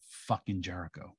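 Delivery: quiet, under the breath curse; low mutter. Retold or imitated. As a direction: grumble it low to yourself. Concussed friend muttering.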